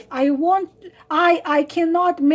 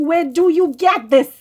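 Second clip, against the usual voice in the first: usual speaking voice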